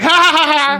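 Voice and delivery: high mock laugh; high-pitched "ha ha ha" imitation; imitated girlish cackle.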